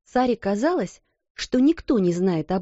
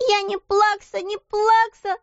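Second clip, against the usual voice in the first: put-on very high childish wail; 14 semitones higher than usual. A narrator's reading. Hurt little girl wailing back at her scolder. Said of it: "завопила Лотти".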